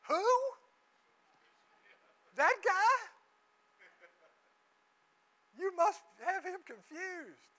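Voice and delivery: stunned Ananias protesting to the Lord; incredulous Ananias. Acted. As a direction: squeak out shocked disbelief at the order.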